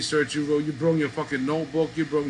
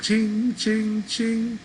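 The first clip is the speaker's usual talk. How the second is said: high sung ching ching, like coins rattling in a cup; coin cup jingle; 10 semitones higher, much slower than usual. Retold or imitated. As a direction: mimic a beggar shaking his cup, high and bright. Mimics beggar shaking coin cup.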